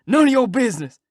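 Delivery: furious outburst. Acted. The king turning on Huck to shut him up, furious shout. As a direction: bite his head off. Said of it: "The king whirls on me and rips out".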